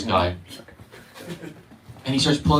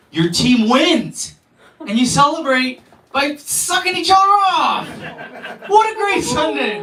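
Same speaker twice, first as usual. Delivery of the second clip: much higher, slow, loud gleeful whoop; hammed-up cheer; 11 semitones higher, 4 dB louder, slower than usual. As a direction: cheer it like a fan gone wild. Mock excited sports fan cheer.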